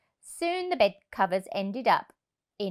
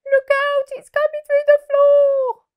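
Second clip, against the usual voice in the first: much higher, much louder warning; alarmed shout; 12 dB louder than usual. Acted. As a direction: yell as the floor moves. Animals alarm.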